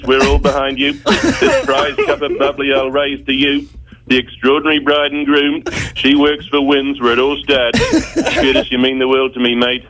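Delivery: ordinary talk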